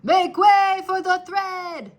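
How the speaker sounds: loud, high, slow call to the crowd; crying out. Acted. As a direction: bellow it to clear the way. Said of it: "cried a mouse".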